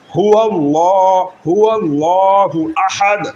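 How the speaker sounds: slow, from the chest, হুয়াল্লাহু drawn out; full chest recitation. Shown as a demonstration. Teacher telling students to recite with the chest: slow, full. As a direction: open the chest and recite slowly. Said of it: "একবারে বুকটা বড় করিয়া".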